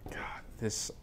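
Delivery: breathless whisper; awed whisper. Real, not acted. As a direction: whisper it in awe. Whispered in awe.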